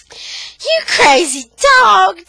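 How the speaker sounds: high, loud, drawn-out playful cry; delighted squeal. A narrator's reading. Tanya to Buddy as he licks her, delighted squeal. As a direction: squeal it slowly at the dog.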